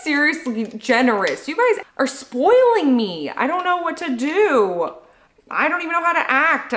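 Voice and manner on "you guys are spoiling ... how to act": high-pitched, overwhelmed gushing; freaking out happily. Real, not acted. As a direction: wail happily, overwhelmed by generosity. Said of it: "I'm just freaking out now".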